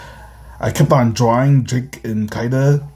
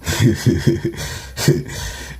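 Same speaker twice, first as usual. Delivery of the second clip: real chuckling. Real, not acted. Narrator amused by own comic, real chuckling. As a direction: run of breathy chuckles. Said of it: "I'm just laughing".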